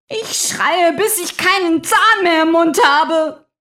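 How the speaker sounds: laut und hoch geschrien; Schreien. Acted. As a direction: trotzig herausschreien. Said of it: "Warum schreien Sie so". (Angeklagte schreit gegen den Richter an)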